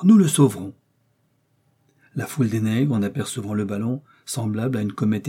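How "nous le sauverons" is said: resolute. A narrator's reading. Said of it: "fit le docteur".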